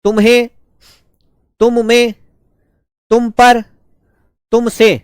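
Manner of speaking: slow, spaced dictation with a pause after each word. Acted. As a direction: call out each word clearly so the students can write it. Dictates word forms to students.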